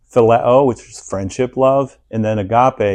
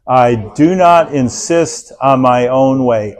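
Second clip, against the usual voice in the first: slow, loud, measured group recitation; congregation reading aloud; 2 semitones higher, 6 dB louder, much slower than usual. Acted. Pastor leading the church in reading, slow and loud. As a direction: lead the room through it word by word. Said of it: "Let's go".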